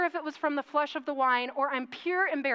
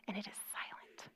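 hushed whisper; 5 semitones lower, 19 dB quieter than usual. Acted. Narrator whispering as she describes the silent church.

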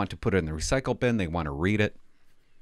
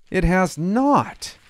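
slow, high, loud flat denial; indignant denial; 9 semitones higher, 5 dB louder, much slower than usual. Real, not acted. Denying the paper's claim, slow high indignation. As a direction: refuse it outright, drawn out.

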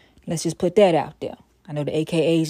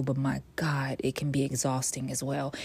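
whispered "but my God", worn out; weary whisper; 3 semitones lower, 7 dB quieter than usual. Real, not acted. Tired of racism talk, whispered aside.

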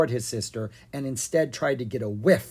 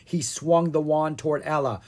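ordinary delivery

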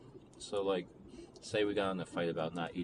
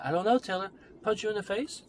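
loud, much higher, exasperated snap; frustrated outburst; 13 semitones higher, 8 dB louder, faster than usual. Retold or imitated. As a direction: patience finally runs out, shouting at Taylor. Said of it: "the frustration of that".

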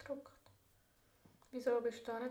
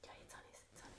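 whisper; 18 dB quieter, much faster than usual. Real, not acted. Whispering game, one wears AirPods.

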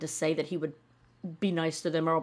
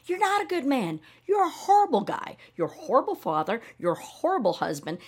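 indignant outburst; 6 semitones higher than usual. Real, not acted. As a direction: tell him off, rising in pitch. Ranting straight at him.

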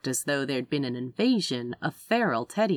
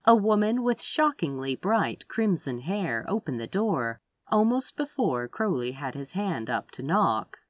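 usual speaking voice

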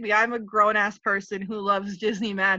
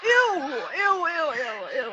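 disgusted ew; 5 semitones higher, much slower than usual. Real, not acted. Disgusted watching video.

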